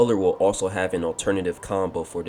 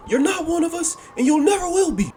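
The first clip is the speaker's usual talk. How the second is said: high-pitched angry shout; angry yell, pitched way up; Loki, angry; 4 dB louder than usual. Acted. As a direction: shout a furious rejection, building louder. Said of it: "as Loki angrily says".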